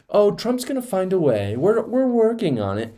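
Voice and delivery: mock mysterious, high and coy; Steve Bannon. Host mimics a cagey guest, mock mysterious. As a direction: ridicule him in a high, evasive singsong. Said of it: "wants to be all mysterious".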